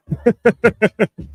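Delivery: high laugh bursting out; laughing at the chat; cracking up again at the joke; real laughter. Real, not acted. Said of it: "Yeah, I'm laughing".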